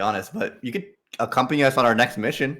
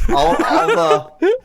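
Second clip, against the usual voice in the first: laughing; 6 dB louder, much slower than usual. Real, not acted. Laughing while speaking.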